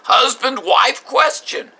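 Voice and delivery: high, loud exasperated outburst, slowing at the end; husband loses his temper demanding an answer from his wife; losing it. Retold or imitated. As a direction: plead in rising frustration at the wife. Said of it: "I start losing it".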